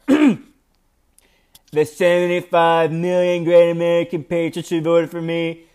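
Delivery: slow, drawn-out politician impersonation; amateur impersonation in a deliberate, measured drawl; Donald Trump impression. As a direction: read it as a put-on presidential voice, stretching the words. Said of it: "I'm gonna read it in his voice".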